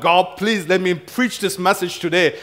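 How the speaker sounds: imitated urgent begging; begging prayer. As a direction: plead with God, half in fun. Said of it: "just pray and beg God".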